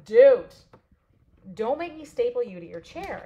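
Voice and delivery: mock scold. Teacher scolding a wandering kid.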